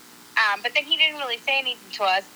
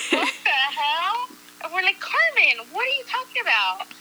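baffled disbelief; 7 semitones higher than usual. Retold or imitated. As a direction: act out being utterly thrown by a friend. Acting out coworkers' baffled reaction.

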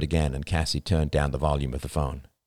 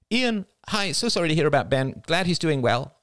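high, polite, sympathetic chatter; sister-in-law on the phone; 10 semitones higher than usual. Acted. Rachel greeting her brother-in-law by phone, sympathetic. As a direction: greet him in a high, friendly voice.

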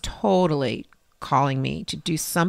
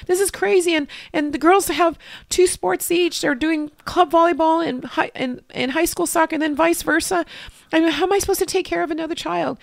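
high, louder overwhelmed wail; retold crying rant; 11 semitones higher, 3 dB louder than usual. To a woman in the church bathroom, a high crying rant. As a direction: spill it all out, near tears. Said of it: "in the bathroom at church crying".

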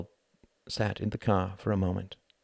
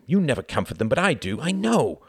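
heated reproach climbing higher; snapping; 6 semitones higher, 5 dB louder than usual. A narrator's reading. Wendy reproaching Reginald in the taxi, snapping. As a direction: scold him, pitch rising to the end. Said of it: "snapped Wendy".

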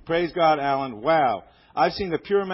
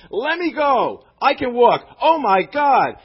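higher and louder, bursting out in joyful disbelief; excited cry; 8 semitones higher, 9 dB louder, slower than usual. Retold or imitated. Inmate breaking free of the preacher's grip, excited shout. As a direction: shout it like you just found you can walk.

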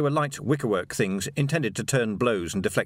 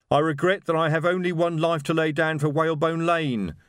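higher, louder heroic declaration; shouted; 6 semitones higher, 3 dB louder than usual. A narrator's reading. Reg Shue shouting from behind a wardrobe, heroic and loud. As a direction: proclaim it like a martyr. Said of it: "the voice shouted".

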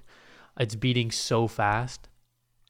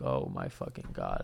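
very low, soft creaky dread; muttered dread; 10 dB quieter than usual. Retold or imitated. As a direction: groan it low under your breath. Dread during the silence.

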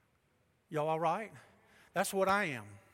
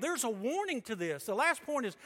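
raised, ringing preacher voice; preaching; 6 semitones higher, 5 dB louder than usual. Acted. Pastor preaching hard to his congregation, loud and ringing. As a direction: preach it out with full force.

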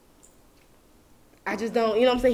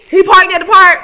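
loud indignant yell; outraged shout about him at the park; 6 semitones higher, 13 dB louder than usual. Real, not acted. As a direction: yell it out in disbelief. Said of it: "I'm sorry for all that yelling".

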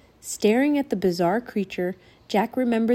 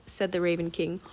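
plain reading voice

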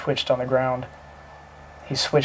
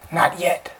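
coughed; 5 semitones higher than usual. A narrator's reading. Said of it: "Howard coughed".

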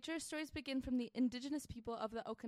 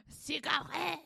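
mock French accent; 3 semitones higher, 4 dB louder, slower than usual. Shown as a demonstration. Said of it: "French mock".

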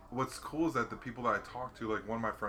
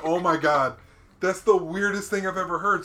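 louder, higher shocked reply; amazed disbelief; 7 semitones higher, 11 dB louder than usual. Retold or imitated. Worker reacts to the bear story, loud. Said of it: "I'm like".

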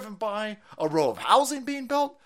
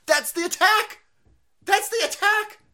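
high, loud repeated exclamation; exasperated yell; 9 semitones higher, 4 dB louder than usual. Real, not acted. Host exposes the tactic to listeners, exasperated yell. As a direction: burst out as if it were obvious.

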